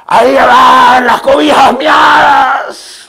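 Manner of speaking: full, drawn-out public shout, high and loud; grito del tío. Retold or imitated. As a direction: holler it down the street to embarrass a child. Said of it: "viene mi tío y me grita". (grito fuerte y alargado)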